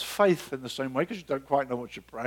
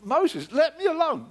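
God pleading; 11 semitones higher, 4 dB louder than usual. Retold or imitated. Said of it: "It's like".

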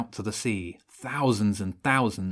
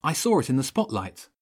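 higher-pitched urgent excitement; excited; 6 semitones higher, 3 dB louder, faster than usual. A narrator's reading. Boy excitedly spots the boat.

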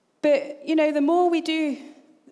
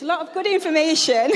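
loud, higher, through laughter; amused, raised voice breaking into laughter; laughing; 7 semitones higher, 4 dB louder than usual. Real, not acted. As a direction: laugh your way through the correction. Joking correction about her husband.

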